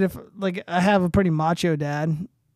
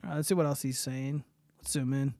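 ordinary talk, nothing marked

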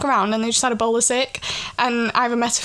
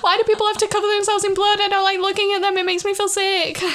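high whiny disgusted voice; younger self disgusted; 8 semitones higher than usual. Retold or imitated. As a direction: whine it like a squeamish kid. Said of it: "I was just like".

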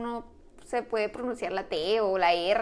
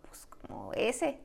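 whisper; 9 dB quieter, much slower than usual. Retold or imitated. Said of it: "Y yo".

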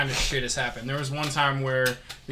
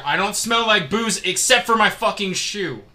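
higher, louder, indignant retelling; retold furious protest; 3 semitones higher, 5 dB louder than usual. Storyteller protesting to cops that he isn't drunk, furious. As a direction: plead your innocence with rising exasperation. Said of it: "I was just furious".

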